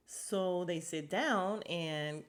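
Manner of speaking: ordinary talk, nothing marked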